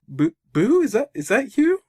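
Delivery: high, mock-astonished question; stunned. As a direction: stammer it in acted disbelief, rising high. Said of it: "he's stunned for a minute".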